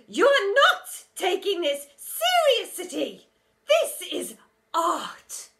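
high, loud, very slow wailing protest; indignant teacher. A narrator's reading. Teacher scolding the laughing hall, slow and wailing. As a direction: plead for seriousness.

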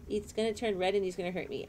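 normal talking voice

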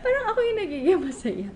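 fast, high, through giggles; laughing. Real, not acted. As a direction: giggle the words out quickly. Laughs at herself on stream.

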